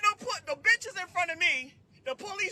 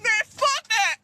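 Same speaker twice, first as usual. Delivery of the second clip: mock shout; 7 semitones higher, 5 dB louder than usual. Repeats the shout.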